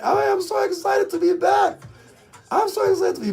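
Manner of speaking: high-pitched, thrilled to be back; excited. Real, not acted. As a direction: let the voice leap up with excitement.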